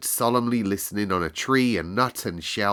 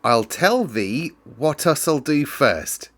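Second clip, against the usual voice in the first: put-on a girl's careful, slightly higher try at Yorkshire; girl attempting Yorkshire; 6 semitones higher, 4 dB louder, slower than usual. A narrator's reading. Mary tries out Yorkshire on Dickon, in a careful attempt at Yorkshire. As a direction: twist the tongue into Yorkshire, slowly and proudly. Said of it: "tried to twist her tongue into speaking Yorkshire".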